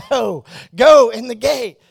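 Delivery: high, slow, loud insisting; slow, high shouted plea; loud refusal. Retold or imitated. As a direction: drag out a loud laughing no. Refusing to go again.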